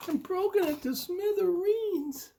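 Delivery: very high, fast cub voice; tiny high wail; Baby Bear. Acted. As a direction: squeal like an upset little cub. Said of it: "said Baby Bear".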